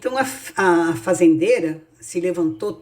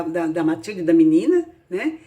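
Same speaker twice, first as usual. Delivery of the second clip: ordinary delivery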